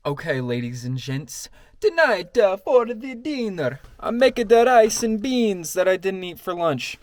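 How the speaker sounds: high, loud showman announcement; announcer voice. Real, not acted. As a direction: announce dinner like a game show host. Announcing dinner to viewers.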